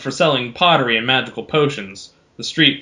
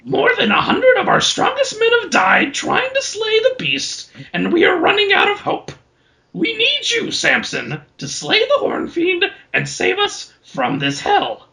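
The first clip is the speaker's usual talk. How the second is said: much higher raspy troll voice; troll pleading. Acted. Troll chief begs Samson to slay the beast, high raspy. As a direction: beg for help like a cheery ancient troll.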